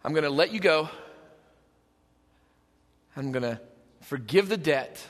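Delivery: tender forgiveness. Acted. God forgiving the debtor out of love, tender and hushed. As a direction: let each phrase settle gently, dropping almost to a hush. Acting God's forgiving reply.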